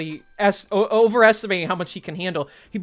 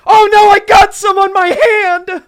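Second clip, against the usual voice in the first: panicked shout; 13 semitones higher, 12 dB louder than usual. Acted. Bartender in the back, panicked yell.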